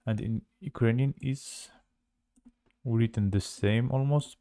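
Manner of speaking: ordinary talk, nothing marked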